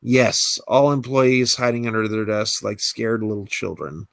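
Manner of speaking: ordinary talk, nothing marked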